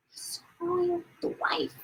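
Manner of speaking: hushed scandalous whisper. Real, not acted. Vlogger confiding scandal to viewers, whispered. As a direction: lean in and whisper the secret.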